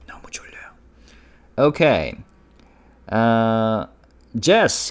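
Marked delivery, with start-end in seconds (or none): 0.1-0.7 breathy whispered sleepy line; soft ASMR-style whisper. Shown as a demonstration. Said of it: "almost like A S M R".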